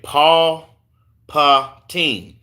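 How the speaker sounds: "Paul Patine" broken into slow, separate syllables; tentative "Paul Patine", pausing after Paul; careful pronunciation. Real, not acted. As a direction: sound out "Paul... Patine" piece by piece. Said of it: "Did I say it right there".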